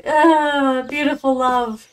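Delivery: hammed-up lovestruck gush; swooning. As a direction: sigh it out like a hopeless romantic. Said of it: "And I'm like".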